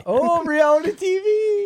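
mock dramatic.

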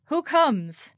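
loud, high sentry's challenge; demanding challenge. Acted. A perimeter guard demands who comes, loud. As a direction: call out sharply from the watch. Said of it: "demanded the perimeter watch".

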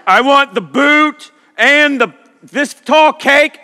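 high, loud and slow, drooling over dessert; greedy excitement. Acted. To the waiter at the dessert tray, stretched, hungry excitement. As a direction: stretch each word out like you crave it.

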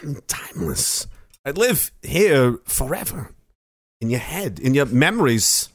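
a slow, creepy celebrity impression with odd pauses; Christopher Walken. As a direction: sound eerily unsettling, pausing oddly mid-phrase. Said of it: "Christopher Walken's always there".

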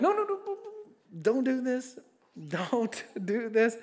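high-pitched pleading protest; mock alarmed high protest. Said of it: "And I was like".

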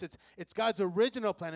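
ordinary talk

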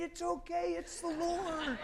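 high, slow pretend reassurance called down the street; mock-call it's okay after fleeing churchgoers; calling after people. Preacher chasing scared people, calling high and slow.

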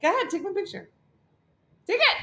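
lightly voiced keen friend; eager friend voice. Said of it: "They'd be like".

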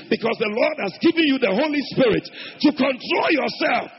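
loud preaching building to a shout. Real, not acted. Preacher to congregation on the Holy Spirit's control, building to a shout. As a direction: drive the sermon up to full force.

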